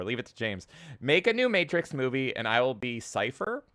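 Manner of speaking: normal talking voice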